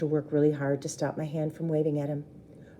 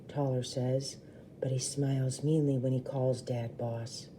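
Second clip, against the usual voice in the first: ordinary delivery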